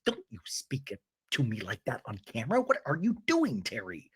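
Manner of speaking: hushed, indignant retort with a lift on the question; low-volume scolding, dropping away at the end; hot mic hiss-aside. Retold or imitated. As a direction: imitate a private telling-off caught on mic. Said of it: "it was the hot mic moment".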